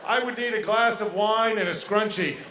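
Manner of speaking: loud, high, drawn-out mock complaining; reluctant contractor. Girlfriend answering like a roof contractor at night, reluctant. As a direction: imitate a contractor sizing up a daunting job. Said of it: "like asking a roof contractor".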